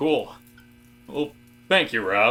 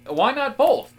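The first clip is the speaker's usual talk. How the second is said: much higher and louder, delighted; eager why-not; 12 semitones higher, 5 dB louder, slower than usual. Acted. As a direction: toss it out like the obvious answer. Eager agreement between coworkers.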